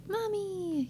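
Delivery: put-on high, soft toddler voice; little kid calling. Mother imitating her little kids calling Mommy. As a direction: call Mommy like a tiny child.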